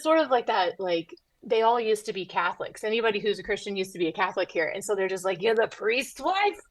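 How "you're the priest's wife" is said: amazed. Retold or imitated. Former Catholics amazed.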